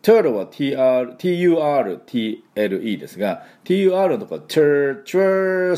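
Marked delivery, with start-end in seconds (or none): 4.4-5.7 tur- drawn out with a held American R; isolated "tur" sound. Shown as a demonstration. Teacher isolating the first syllable, tur- stretched out. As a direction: stretch tur- higher, holding the R colour. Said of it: "のとこです".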